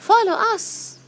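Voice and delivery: high, loud cheery call. Acted. Fireflies urge the bee to come along, calling out. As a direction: call them along brightly.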